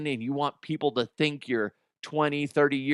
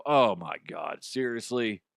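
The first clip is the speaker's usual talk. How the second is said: mock disbelief; 5 semitones lower than usual. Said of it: "wouldn't that make you go".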